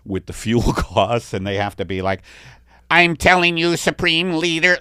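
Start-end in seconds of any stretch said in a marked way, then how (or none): 2.9-4.8 alien worker voice. Pretend. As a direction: beg the leader in a silly alien voice. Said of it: "this is the Italian alien talking to his leader".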